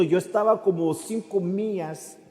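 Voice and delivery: normal talking voice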